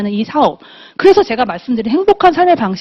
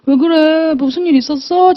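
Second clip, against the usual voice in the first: higher-pitched retold alarm; startled concern; 5 semitones higher than usual. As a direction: ask as a wife taken aback and worried. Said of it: "깜짝 놀래가지고". (깜짝 놀라 걱정하듯 높은 목소리로)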